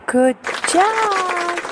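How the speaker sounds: high, bright cheer; praise. Acted. Mother praising baby for counting, bright high cheer. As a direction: cheer the little one on.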